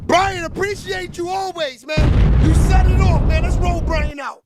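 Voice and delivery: loud hype shout-out to a viewer. Real, not acted.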